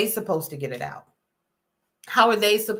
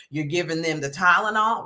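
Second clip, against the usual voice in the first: normal talking voice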